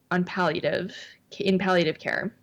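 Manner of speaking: usual speaking voice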